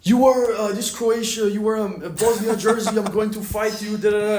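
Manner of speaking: imitating a hostile guy squaring up; mock angry stranger. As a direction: play the angry local picking a fight. Angry stranger confronting on port.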